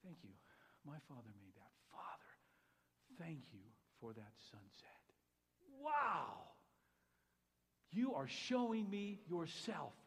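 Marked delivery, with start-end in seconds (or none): 0.0-5.1 soft whispered thanks; remembered whisper. Retold or imitated. The remembered pastor thanking God: soft whisper. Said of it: "I hear Lee Tipton's voice going". 5.8-6.5 amazed wow. Acted. Awe at the sunset colors.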